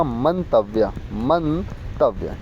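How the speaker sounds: Mantavya stretched slowly and softly; slow soft repeat. Shown as a demonstration. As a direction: say Mantavya slowly as a final answer. (मंतव्य धीमे और धीरे से)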